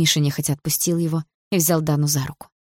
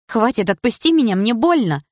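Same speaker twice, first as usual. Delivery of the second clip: higher, urgent plea; pained protest; 7 semitones higher, 4 dB louder than usual. A narrator's reading. Dana to Andrei gripping her shoulder, urgent pained protest. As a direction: protest sharply, pitched up in pain.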